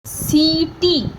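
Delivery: "सीटी" very slowly, both ee vowels held long; "सीटी" in two long syllables; stretched ee. Shown as a demonstration. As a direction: draw out "सी", then "टी". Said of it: "सा को भी हम खींच रहे हैं".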